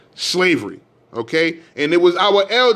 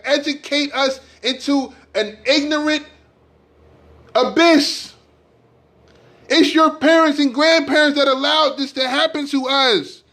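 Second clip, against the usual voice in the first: emotional; 8 semitones higher than usual. Real, not acted. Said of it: "I'm getting emotional".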